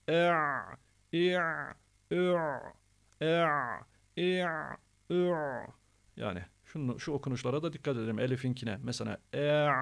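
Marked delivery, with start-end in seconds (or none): 0.1-5.3 "E, i, ü" held into a tight pharyngeal ayn; squeezed throat. Shown as a demonstration. Teacher demonstrating ayn, squeezed "E, i, ü". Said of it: "Bununla da sıkacağız". 9.3-9.7 "e" starting clean, tightening into ayn; squeezed onset. Shown as a demonstration. Teacher pointing out the onset, held "e" into tight throat. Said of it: "diye giriyoruz".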